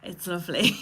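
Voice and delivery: a choked little laugh rising at the end; laughing it off so she doesn't cry; teary laugh. Real, not acted. Host reacts to the poem she found, teary laugh.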